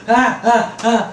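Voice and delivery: short, high, loud cries; imitated pained yelps. Brother stung by a wasp on the antenna, imitated yelps. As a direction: yelp repeatedly as if being zapped. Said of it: "I'm thinking he's getting electrocuted".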